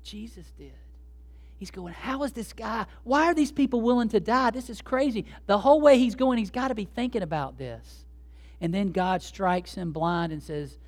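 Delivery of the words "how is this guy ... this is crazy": higher, incredulous questioning; bewildered. Acted. Preacher voicing Saul wondering about Stephen's death, bewildered. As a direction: act out baffled disbelief. Voices Saul's bewildered thoughts on the road.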